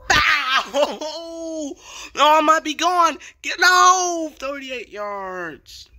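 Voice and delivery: very high, loud whoop with laughter; wild real hype, trailing into a slow high finish; ecstatic yell. Real, not acted. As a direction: scream with joy at the breakaway, then stretch out the last words. Celebrating a long breakaway run.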